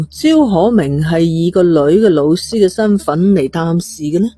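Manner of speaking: plain reading voice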